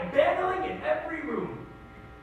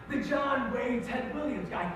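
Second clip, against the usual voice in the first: usual speaking voice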